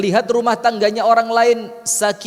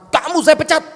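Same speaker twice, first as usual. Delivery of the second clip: a sudden high, loud outburst; angry firing; 9 semitones higher, 5 dB louder than usual. Acted. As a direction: snap out the firing in anger. An angry boss fires a worker. (bos yang marah memecat pegawai)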